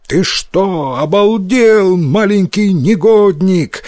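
put-on a moderately angry old man's outburst; angry yelling. A narrator's reading. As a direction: yell indignantly at a naughty boy, without full force. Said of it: "заорал он на Йотару".

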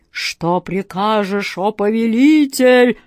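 slow, booming genie voice; booming arrogant alien overlord. A narrator's reading. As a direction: boom it out like a giant genie. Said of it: "прогремел джин".

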